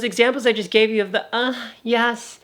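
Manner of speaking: squeezed throat, strained voice; pinched voice. Shown as a demonstration. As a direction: squeeze the throat tight as you speak. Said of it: "I can do it like that ... squeezing my throat".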